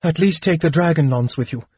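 high, hurried plea; anxious, quick entreaty; begging. A narrator's reading. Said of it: "she begged".

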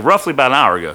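ordinary talk, nothing marked

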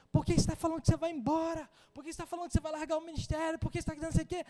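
high, fast, rapid-fire questions; scolding sister. Retold or imitated. Church sister confronting Jonatas about quitting: high, fast scolding. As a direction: confront him with a pointed finger.

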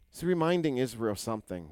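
normal talking voice

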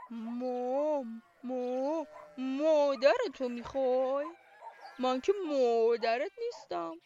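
a big cow mooing its answer; lazy cow. Acted. Cow tells the chick she isn't its mum, slow mooing voice. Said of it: "یه نگاهی بهش کرد و گفت".

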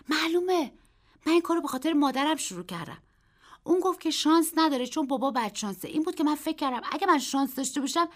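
put-on high, loud and quick boyish voice; a boy swept up explaining his luck; excited boy. A narrator's reading.

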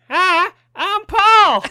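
very slow, high monkey hoot. Pretend. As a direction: stretch it out like a goofy monkey. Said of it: "[name], just do it".

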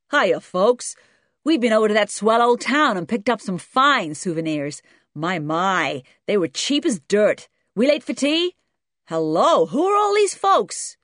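loud, high-pitched American dad; hearty American father. A narrator's reading. American father greeting a table of strangers, hearty and loud. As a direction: boom it out like a hearty American tourist.

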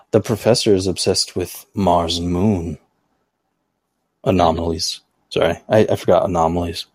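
lightly put-on low voice. Podcaster reading out a listener's comment, put-on low voice. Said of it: "I don't know why I'm doing this voice".

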